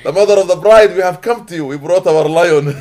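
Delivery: loud, high, hammed-up proclamation; mock-proud wedding boast. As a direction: belt out the boast, laughing around it. Repeats wedding boast amid laughter.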